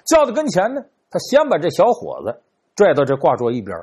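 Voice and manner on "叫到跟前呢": usual speaking voice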